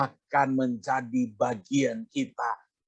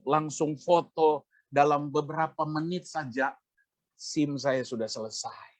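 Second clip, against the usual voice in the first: ordinary talk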